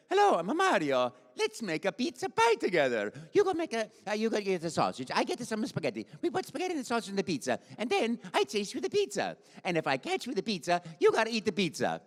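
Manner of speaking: high, playful Italian cartoon voice; young, fun-loving Italian plumber, bouncy and high; Mario. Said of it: "I'll make him young, and I'll make him have fun".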